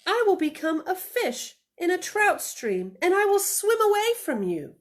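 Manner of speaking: high, loud bunny voice; little bunny voice. Acted. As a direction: play the little bunny daring his mother. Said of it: "said the little bunny".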